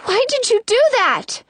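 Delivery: high-pitched complaint; indignant protest. A narrator's reading. Snow asks her rescuer from the floor, indignant. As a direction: protest with voice jumping up.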